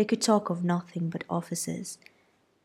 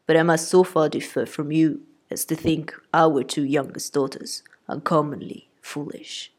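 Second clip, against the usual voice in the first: deadpan husband's retort; dry father; 2 semitones lower, 5 dB louder than usual. A narrator's reading. Mr Bennet voiced.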